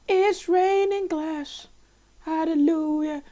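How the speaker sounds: slow, high wondering voice; awed high voice. Pretend. Gamer marvelling slowly as glass falls.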